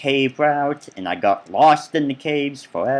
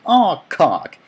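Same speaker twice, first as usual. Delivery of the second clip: higher, louder mock exclamations; quoted gripes, pitched up and punched; reviewer impression; 4 semitones higher, 4 dB louder, slower than usual. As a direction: imitate a game reviewer cursing in exasperation. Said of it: "the guy's little voice is in my head".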